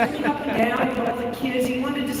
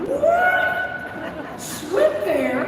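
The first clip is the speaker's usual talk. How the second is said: high, startled squeak of disbelief; astonished; 11 semitones higher, much slower than usual. Retold or imitated. Girl reacts in disbelief to mother's idea, high astonishment. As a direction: gasp it out, amazed.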